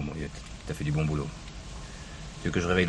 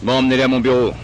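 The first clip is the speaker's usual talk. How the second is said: barked order; 5 semitones higher, 10 dB louder than usual. Acted. Commissioner orders men after arrest.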